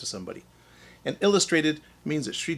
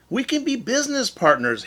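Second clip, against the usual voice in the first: eager salesman; 11 semitones higher, 3 dB louder than usual. Acted. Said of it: "Hare said".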